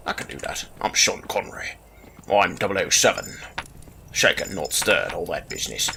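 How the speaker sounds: low, soft Scottish Sean Connery voice; a hushed, lightly done Scottish James Bond; Scottish accent, low and quiet like Sean Connery; Sean Connery impression. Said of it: "talk the whole episode like Sean Connery".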